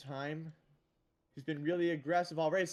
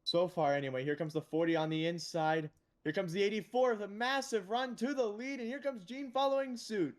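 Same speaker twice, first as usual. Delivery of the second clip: commentary rising into higher, excited calls; live race play-by-play; 5 semitones higher than usual. Real, not acted. Commentator calling a run for the lead, excited play-by-play. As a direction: lift the pitch as each car makes its move.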